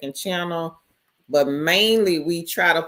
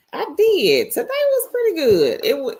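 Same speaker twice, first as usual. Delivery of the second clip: bright excited answer; 10 semitones higher, 3 dB louder than usual. Real, not acted. Answering chat about her day.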